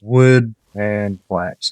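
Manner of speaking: each word of wood and flax drawn out slowly; slow emphasis. Real, not acted. Giving a key gameplay tip.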